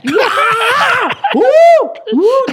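high yelping shriek; retelling a fight with a hammed-up scream; mock scream. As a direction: yelp like being grabbed. Reenacting being grabbed, screaming.

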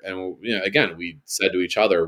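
ordinary delivery

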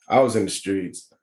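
ordinary delivery

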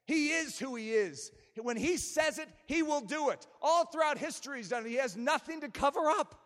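high, emphatic pulpit delivery; forceful preaching. Real, not acted. Preacher assures congregation God keeps his word, forceful preaching. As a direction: preach it with conviction.

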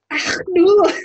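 loud, high Scottish wail; attempted Scottish accent. Player running towards dwarves as dwarven lady, loud Scottish wail. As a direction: cry out in a hammed-up Scottish accent. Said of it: "I don't know the difference between the Scottish accents".

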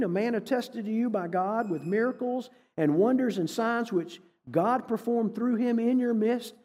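ordinary talk

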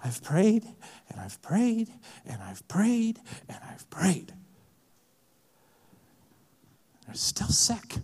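worn-down pleading, each repeat heavier, fading into a breath; acted desperate, breathy lament; anguished prayer. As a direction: pray it out, voice giving way to near-whisper.

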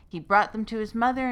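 usual speaking voice

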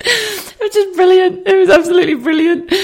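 very high, squealing through giggles; helpless giggles. Real, not acted. Teller recalling the turtle head moment, squealing with laughter. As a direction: squeal it out, tickled by the memory.

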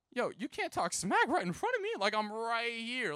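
high-pitched mock outrage; indignant protest. Teen to aunt and dad talking about him, indignant. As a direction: object in disbelief, pitch leaping up.

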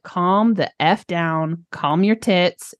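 slow, deliberate calming words; drawn out to settle herself down; slow, calm self-talk. Retold or imitated. Her own inner voice telling her to calm down, slow and deliberate.

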